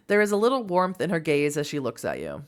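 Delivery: ordinary delivery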